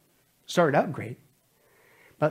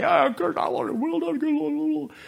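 mock eager; 12 semitones higher than usual.

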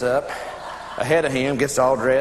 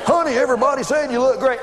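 high-pitched acted exasperation; exasperated bickering; 6 semitones higher than usual. Couple bickering in the car after the party, exasperated. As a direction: climb higher as the argument goes. Couple argue driving home.

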